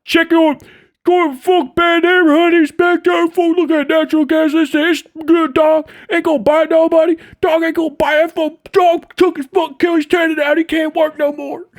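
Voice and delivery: high, loud, heated street voice defending his uncle; pissed-off guy. Retold or imitated. Angry relative yelling about his uncle's pit bull bite, high and loud. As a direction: rant like an angry guy from the neighborhood. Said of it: "somebody's gonna be pissed".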